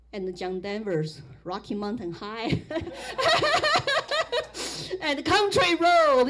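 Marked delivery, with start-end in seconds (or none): 2.7-4.9 a high delighted laugh. Real, not acted. Laughing at her old music crush. 5.0-6.3 said through laughter, slow and loud; laughing fondly at the song title. Real, not acted. Laughing while naming the song.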